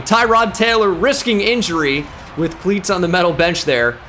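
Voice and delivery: ordinary talk, nothing marked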